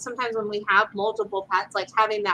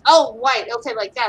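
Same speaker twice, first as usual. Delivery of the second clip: normal talking voice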